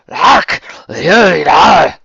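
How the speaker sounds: high, loud, gloating Scots villain cry; gleeful villain shout. Acted. Scottish villain finding the object of his quest, gleeful shout. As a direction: shout it out in evil glee. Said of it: "in evil glee".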